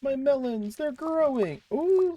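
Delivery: high excited squeal; delighted high voice over crops. Real, not acted.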